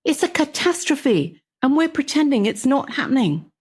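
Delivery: high-pitched, pleading protest; urgent protest. Acted. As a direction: plead the case, pitched well up. Acted quarrel in novel reading.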